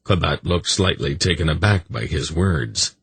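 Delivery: ordinary delivery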